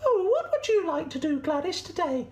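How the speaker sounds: very high squeaky sheep voice; Sally the sheep. Acted. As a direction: ask politely in a tiny high squeak. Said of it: "Sally would say".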